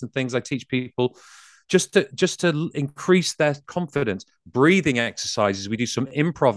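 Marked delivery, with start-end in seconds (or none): none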